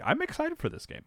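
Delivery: sudden high squeal of excitement; excited. Retold or imitated. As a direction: gush like a thrilled fan. Retells own growing hype.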